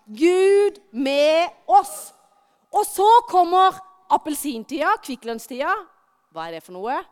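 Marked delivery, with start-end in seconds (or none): 0.1-2.1 "Gud med oss" said very slowly, every word stretched; slow group recital. Shown as a demonstration. Preacher leading children to recite "Gud med oss" slowly. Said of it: "Vi må si det høyt alle sammen".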